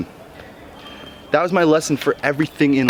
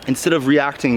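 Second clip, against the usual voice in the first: normal talking voice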